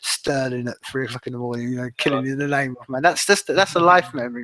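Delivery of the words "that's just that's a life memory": lifted, louder with fond excitement; higher and louder, buzzing about a great night; excited. Real, not acted. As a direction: savour the memory out loud. Fond memory, voice lifts.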